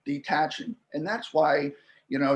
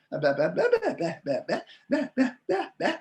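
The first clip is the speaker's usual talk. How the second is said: babbled bah syllables climbing much higher; baby babbling; 9 semitones higher, faster than usual. Shown as a demonstration. Linguist demonstrating infant babbling to the interviewer, babbled and climbing high. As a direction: imitate a baby babbling, rising up the scale. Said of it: "I'm sixty-two and I can still do that".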